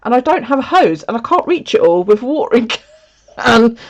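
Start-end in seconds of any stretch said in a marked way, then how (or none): 0.0-2.7 high, loud retold protest; retelling her protest against the sun coming out; mock protest. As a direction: push back in mock alarm. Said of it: "I was like".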